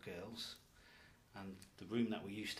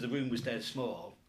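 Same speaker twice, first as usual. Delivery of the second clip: ordinary talk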